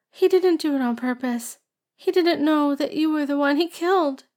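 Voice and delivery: put-on tearful girl's prayer. A narrator's reading. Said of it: "She sobbed on".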